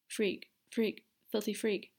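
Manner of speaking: put-on a frightened child repeating the words under her breath; frantic muttering. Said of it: "Ariana repeated frantically".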